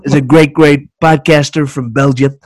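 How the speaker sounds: Trump impression with a grimace; Trump voice demo. Shown as a demonstration. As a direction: flatter the host like Trump. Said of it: "just doing the face really helps the voice".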